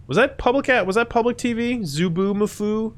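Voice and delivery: high-pitched doubtful wondering; squeaky puzzled query; puzzled questioning. Real, not acted.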